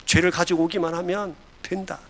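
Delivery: low, hushed reassurance; God reassuring believers. Acted. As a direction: speak gently down to a child, promising acceptance. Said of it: "그 하나님의 우리를 향하여서".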